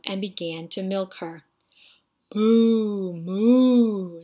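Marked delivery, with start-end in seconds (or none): 2.3-4.2 loud, long cow moos; mooing cow. A narrator's reading. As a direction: moo like a cow. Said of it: "said the spotted cow".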